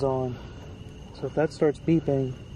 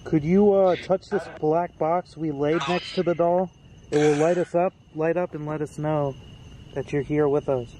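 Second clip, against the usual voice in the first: louder, addressing someone unseen; calling to a spirit; 6 dB louder than usual. Real, not acted. Ghost hunter asks a spirit to touch a box, calling out. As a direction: call out a request to a spirit.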